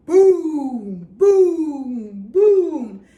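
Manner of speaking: very loud booming thunder. Shown as a demonstration. Teacher shows kids thunder with huge loud booms. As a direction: boom it out like a thunderclap. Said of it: "Mister Brown makes thunder".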